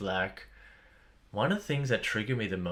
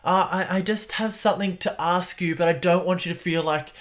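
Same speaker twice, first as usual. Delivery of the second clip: apologetic; 9 semitones higher, 4 dB louder than usual. Retold or imitated. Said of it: "It's like".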